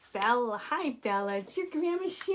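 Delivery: high, sing-song pet voice; high cooing pet talk. Real, not acted. Talking to the dog.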